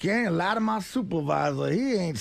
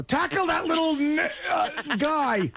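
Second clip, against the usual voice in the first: shouted quote; 6 semitones higher, slower than usual. Acted. Imagined brother slipping up.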